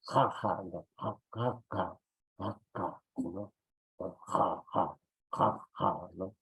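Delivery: ja, ja repeated soft, throaty and fading; Quechua ja drill. Shown as a demonstration. Teacher drills the ja sound the Spanish lack, soft and throaty. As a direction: breathe out each ja from the throat. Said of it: "ese es el sonido ja".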